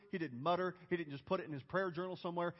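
usual speaking voice

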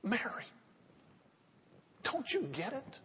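hushed. Real, not acted. Pastor pleading with Mary through the congregation, hushed. Hushed point about Jesus weeping.

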